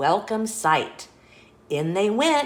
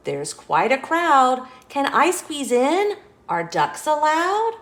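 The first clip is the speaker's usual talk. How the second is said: higher, louder little duck; 7 semitones higher, 4 dB louder than usual. A narrator's reading. Duck asking Little Blue if ducks are allowed, higher. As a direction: ask eagerly as the duck.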